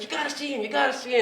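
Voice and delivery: high, eager urging; a proud mother urging the family on; excited mother. Retold or imitated. Mother telling relatives to visit her son, high and eager. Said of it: "she's like".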